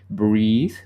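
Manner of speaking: usual speaking voice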